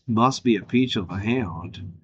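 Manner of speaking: a hint of a slow low Southern drawl; lightly voiced gambler, cool and sly; Val Kilmer as Doc Holliday. Quoting Doc Holliday at the card table, lightly done. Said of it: "like Val Kilmer from Tombstone".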